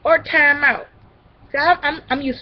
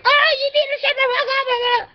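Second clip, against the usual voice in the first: mock angry scolding. Vlogger showing what she needn't do, mock scolding. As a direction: squawk it as a parody of scolding. Mimics an angry yelling adult.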